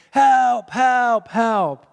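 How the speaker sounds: loud, high repeated "help"; acted shout from the background; yelling for help. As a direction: call out long, falling cries for help. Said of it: "[name]'s in the background saying".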